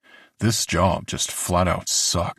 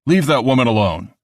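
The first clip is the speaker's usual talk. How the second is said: firm order; 5 semitones higher, 5 dB louder than usual. A narrator's reading. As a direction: order them to stop. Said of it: "he ordered".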